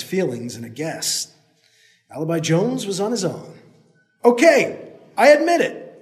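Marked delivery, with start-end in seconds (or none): 4.2-6.0 loud, very high yell. Acted. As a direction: yell it downhill. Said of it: "Alibi yelled".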